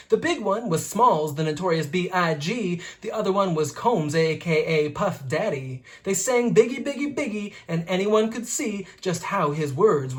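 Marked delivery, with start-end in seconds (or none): none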